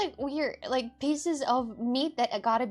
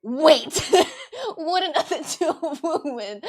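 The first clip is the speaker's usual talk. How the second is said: laughing surprise; 4 semitones higher, 8 dB louder than usual. Retold or imitated. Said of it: "I'm like".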